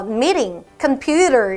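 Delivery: American "meeting, computer" with flapped T, like D; American soft T. Shown as a demonstration. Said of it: "미국에서는 T 발음을 많이 없애요". ("meeting, computer" 미국식, T 발음 약하게)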